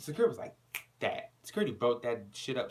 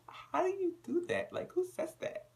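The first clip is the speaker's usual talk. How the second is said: incredulous; 3 dB quieter than usual. Real, not acted. Speaker incredulous.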